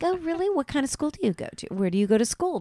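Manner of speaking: high, quick mock enthusiasm; fake interested adult. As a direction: grill the child sweetly.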